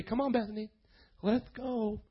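very soft, tender parent's voice; gentle coaxing. Acted. Preacher as a parent to little Bethany, gently coaxing. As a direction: coax a toddler softly along.

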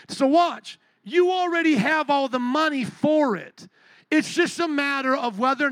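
loud, high preacher's punch line; emphatic preaching. Real, not acted. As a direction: hit the point hard from the pulpit. Preacher drives home his point.

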